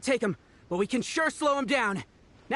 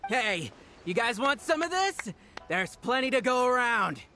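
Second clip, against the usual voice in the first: high, slow, goading call; taunting challenge; 7 semitones higher than usual. Acted. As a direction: dare them to come closer. Henchman taunts the guards.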